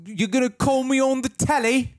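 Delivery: high, loud British caricature; mock London accent. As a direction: squawk the question as a cartoon Londoner. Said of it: "you sound like you're from London".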